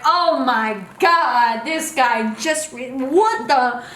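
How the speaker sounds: slow, drawn-out amazement; retold starstruck shock. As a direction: act out stunned disbelief, stretching the words. Retold shock at recognizing the singer.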